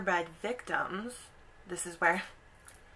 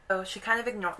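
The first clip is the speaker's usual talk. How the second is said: normal talking voice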